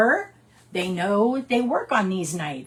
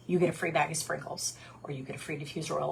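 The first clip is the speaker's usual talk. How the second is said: whispered; 4 semitones lower, 9 dB quieter, faster than usual. Shown as a demonstration. Whispered script line.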